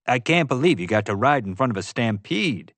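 usual speaking voice

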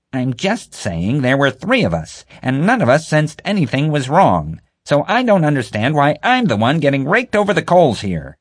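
high, loud indignant protest; getting her dander up. A narrator's reading. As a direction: push back, rising indignation. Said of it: "getting her dander up".

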